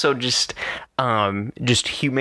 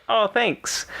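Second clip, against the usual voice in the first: mock chirpy thanks; 11 semitones higher than usual. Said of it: "And I'm like".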